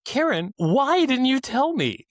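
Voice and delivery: hammed-up disbelief; mock exasperation. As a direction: play up the betrayal, stretching it out. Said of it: "And I was like".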